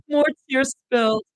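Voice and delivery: ordinary delivery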